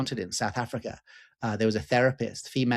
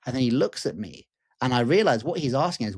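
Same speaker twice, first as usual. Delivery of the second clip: ordinary delivery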